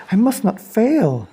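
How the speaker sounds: little snail steeling herself; determined snail. Acted. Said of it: "said the tiny snail".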